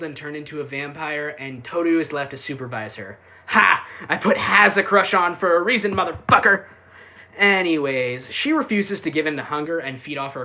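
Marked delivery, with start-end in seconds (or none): 3.5-6.7 gloating shout. Real, not acted. Gloating about her crush joke.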